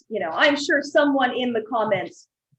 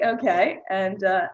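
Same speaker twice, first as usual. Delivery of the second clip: ordinary talk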